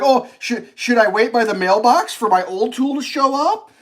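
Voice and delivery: sarcastic retort to the rep; sarcastic question. Retold or imitated. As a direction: ask it sarcastically. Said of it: "I started laughing. I was like".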